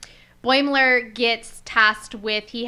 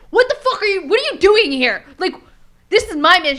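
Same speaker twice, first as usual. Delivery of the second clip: high, loud mock indignation; angry outburst; 8 semitones higher, 8 dB louder, faster than usual. Officer confronting an intruder on his mission, acted angry outburst. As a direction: act out an outraged, louder challenge. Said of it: "he was pretty angry".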